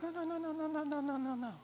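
a string of high, gentle no's; high soothing no's. Retold or imitated. God hushing the panicked believer with high soft no's. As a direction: wave off the worry with high light no's.

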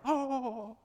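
imitated woman's drawn-out, high "oh"; sensitive sympathy. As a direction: coo with exaggerated concern for a fallen baby. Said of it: "they're sensitive".

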